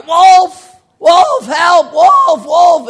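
high, loud panicked yells; imitating a boy screaming for help; boy crying wolf. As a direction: shout for help like a frightened boy. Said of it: "he'd cry".

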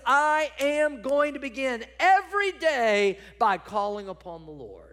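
high, loud declaration dropping at the close; emphatic resolve. Acted. Preacher as David vowing daily prayer, forceful then settling.

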